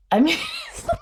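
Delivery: high breaking laugh; real laugh before tearing up. Real, not acted. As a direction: laugh through the upset. Laughs while upset.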